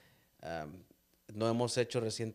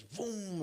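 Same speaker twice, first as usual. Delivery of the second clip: car whoosh; 9 semitones higher than usual. Retold or imitated. Car zooming past.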